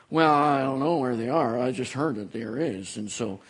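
low, fading mumble of someone unsure; mocking clueless person. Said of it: "I shouldn't be mocking people talking like that".

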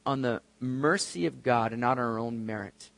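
ordinary talk, nothing marked

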